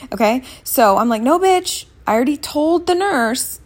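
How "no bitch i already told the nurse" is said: high, mock-indignant comeback; sassy retort. Patient retells snapping back at the doctor, high and sassy. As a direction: fire it back high with sass.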